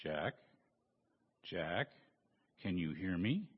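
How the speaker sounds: low, soft divine voice calling down; voice of God. Acted. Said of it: "he heard a voice".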